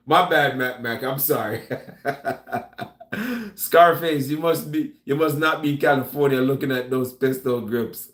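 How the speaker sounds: normal talking voice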